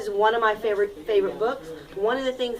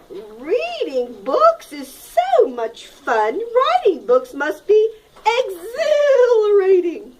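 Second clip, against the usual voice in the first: excited; 8 semitones higher, 4 dB louder, slower than usual. Acted. Hen voiced in read-aloud.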